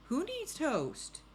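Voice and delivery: high, slow drawn-out question; questioning officer. Acted. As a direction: stretch out the storybook question in a high voice. Officer asks, voiced in story.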